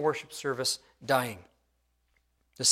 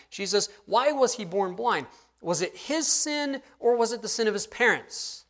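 higher, pressing question; 7 semitones higher than usual. Retold or imitated. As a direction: put it to the teacher, pitched up. Disciples put puzzled question to Jesus.

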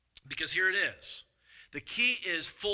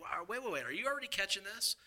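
usual speaking voice

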